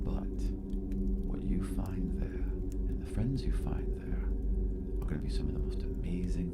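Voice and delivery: sinking into a breathy whisper; spirit in the mirror. Acted. Des in the mirror whispering encouragement to the girl. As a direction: promise it in a secret whisper. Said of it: "she looks at you and she's like".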